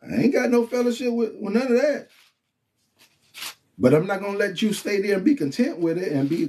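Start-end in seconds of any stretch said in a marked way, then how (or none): 0.0-2.1 mock self-righteous voice. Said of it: "Those be like".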